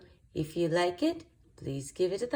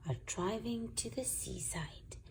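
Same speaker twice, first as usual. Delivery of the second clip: whisper; 11 dB quieter than usual. Acted.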